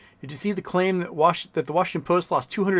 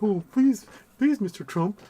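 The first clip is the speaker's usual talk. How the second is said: high, hammed-up pleading billionaire; mock Bezos begging for contracts, higher; 7 semitones higher than usual. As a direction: beg Mister Trump in a simpering voice. Mocking Bezos begging Trump.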